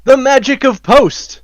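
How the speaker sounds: high, loud showman proclamation; grand announcement. Acted. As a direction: announce it like a magic trick. Joking about editing magic.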